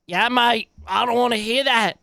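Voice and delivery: a jokey Aussie voice, dismissive; Aussie accent. Pretend. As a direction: play it as an Aussie bloke brushing someone off. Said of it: "Sorry, that's Aussie".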